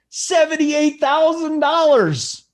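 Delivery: slow, high and hyped, selling the number; excited. Real, not acted. Hyping a big winner's total.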